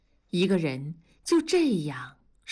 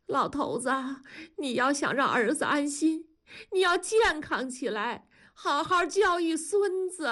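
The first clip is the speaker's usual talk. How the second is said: high, urgent, tearful appeal; calling out; 7 semitones higher than usual. A narrator's reading. Wife rousing husband with news of grandchild, calling out. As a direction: rouse him with rising, emotional pleas. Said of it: "大声地说".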